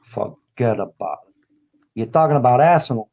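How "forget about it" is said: mock mobster. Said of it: "you say".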